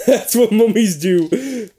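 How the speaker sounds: repeating it through laughter; laughing. Real, not acted. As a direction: laugh it out slowly.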